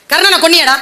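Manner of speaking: angry demand. Retold or imitated. Dharma scolding Arjuna.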